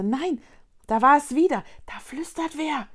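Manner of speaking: high, urgent alert; spellbound. A narrator's reading. Vicky alerts her friends to a whisper, spellbound.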